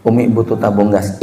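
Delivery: low, soft wifely request; gentle request. Acted. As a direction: ask softly, close beside your husband. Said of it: "daripada jenengan teriak-teriak".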